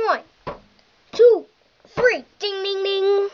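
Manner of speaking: excited wrestling commentator calling the action; hyped ring announcer building to the winner; live play-by-play. Acted. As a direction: call each move like a ringside announcer.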